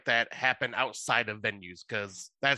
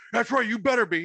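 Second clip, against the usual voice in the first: high, loud aggressive comeback; angry retort; 9 semitones higher, 4 dB louder than usual. Retold or imitated. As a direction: snap back like a riled street guy. Homeless guy retorts to cop.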